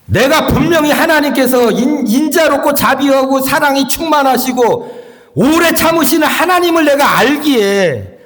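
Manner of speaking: high-pitched protest, lightly acted; defiant Jonah. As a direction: argue back at God, insisting you knew it all along. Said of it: "요나가 삐딱해가지고 하나님과 대항해서". (삐딱하게 따지는 높은 목소리)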